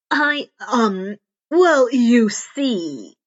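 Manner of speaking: hesitant, slow halting start; flustered searching for words; stammering. Acted. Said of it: "Izuku stammered".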